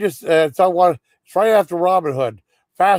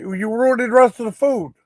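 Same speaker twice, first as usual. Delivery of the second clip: mock whiny kid; 7 semitones higher than usual. Nephew complains about the food, in a high mock whine.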